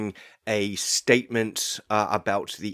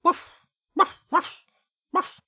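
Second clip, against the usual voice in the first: dog barking. Acted. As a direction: bark like an excited little dog. Dog detective barking outside.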